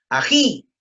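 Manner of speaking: "Ají" high and loud, stress on "jí"; stressed final syllable. Shown as a demonstration.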